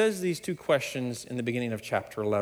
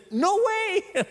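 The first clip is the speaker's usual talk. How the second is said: emphatic Paul. Retold or imitated. Pastor voicing Paul to the congregation, emphatic and high. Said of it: "what Paul says emphatically".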